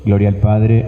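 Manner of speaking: usual speaking voice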